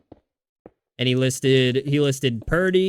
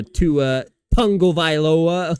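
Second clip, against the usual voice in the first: Tagovailoa slowly and heavily emphasized; exaggerated mock stress on Tagovailoa; Cam Newton; 3 semitones higher, 4 dB louder, much slower than usual. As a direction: lean hard on every syllable of Tagovailoa. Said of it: "putting so much emphasis in his last name".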